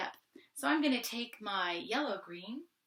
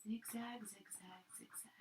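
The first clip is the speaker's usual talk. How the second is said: zigzag said softer each time, the last almost gone; soft voice fading to a whisper; 11 dB quieter, slower than usual. Shown as a demonstration. Art teacher showing kids a soft crayon stroke, fading to a whisper. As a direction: let each zigzag fade until it is only a whisper. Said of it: "but a softer voice".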